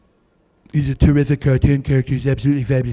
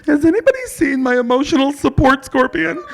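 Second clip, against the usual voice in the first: frantic passenger; 12 semitones higher than usual. Acted. Comic as frantic passenger begging the plane crew. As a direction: play a hysterical flyer who lost her pet.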